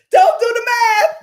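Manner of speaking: loud, high cry of protest; laughing wail. Real, not acted. Streamer wails at chat counting her money, loud laughing cry.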